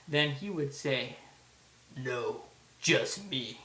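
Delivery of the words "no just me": low, slow, menacing horror villain; slow, low-pitched slasher impression; Jackie Earle Haley's Freddy. Shown as a demonstration. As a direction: draw each word out, low and sinister. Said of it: "this is my little impression of, uh, his voice".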